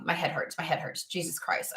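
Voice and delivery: low, soft, drained complaint fading to a whisper; mock weary groan. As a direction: sound worn out and needy. Acting a drained coffee-seeker.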